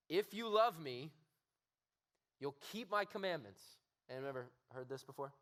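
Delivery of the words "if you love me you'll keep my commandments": stern God. Shown as a demonstration. Preacher voicing an angry God to the youth, loud stern demand. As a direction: bark it like a demanding master. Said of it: "this is how I would read this".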